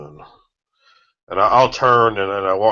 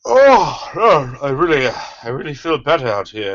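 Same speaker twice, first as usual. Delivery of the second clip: relieved high exclaim; 5 semitones higher, 4 dB louder than usual. Acted. Relieved outburst outside.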